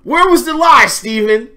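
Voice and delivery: loud, high gloating challenge; taunting challenge. Real, not acted. Host taunts the columnist after the clip, loud gloating. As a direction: throw the question at him triumphantly.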